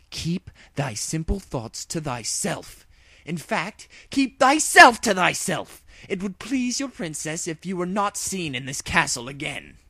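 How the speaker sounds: cold regal fury; furious royal princess. A narrator's reading. Furious princess threatens guard.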